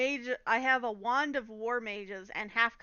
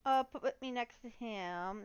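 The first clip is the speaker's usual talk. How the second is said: normal talking voice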